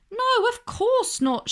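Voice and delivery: put-on high, lisping girl monkey asking why; a light lisping friend, puzzled; Bubbles, puzzled. Bubbles asking Desmond why she should watch, high and lisping.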